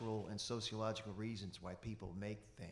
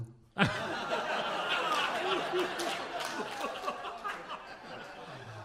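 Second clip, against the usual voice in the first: big laugh; 8 dB louder than usual. Real, not acted. Laughs at his punchline.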